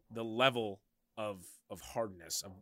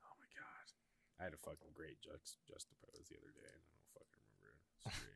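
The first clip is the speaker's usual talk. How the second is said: whisper; 7 semitones lower, 23 dB quieter than usual. Real, not acted. Whispering aside.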